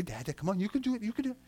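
put-on hushed, rising baby-directed coaxing; coaxing parent. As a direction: gently urge a baby on. Acting parent coaxing baby to speak.

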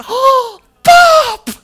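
put-on high, loud excited kid's cry of Pop; child's joyful shout. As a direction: shout it like a delighted little boy at the window. Grandson spots grandpa, shouts joyfully.

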